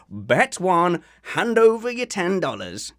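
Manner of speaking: put-on American accent, lively and lifted. Host claiming he won a bet on his American accent, cocky put-on American. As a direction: boast in a hammed-up American accent. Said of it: "I could do a perfect American accent".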